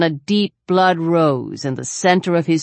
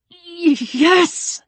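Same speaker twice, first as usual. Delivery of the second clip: teeth chattering; 11 semitones higher than usual. A narrator's reading. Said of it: "Peter chattered".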